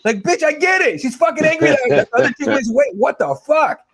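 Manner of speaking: high-pitched fed-up complaint; exasperated rant. Retold or imitated. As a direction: push back in comic exasperation.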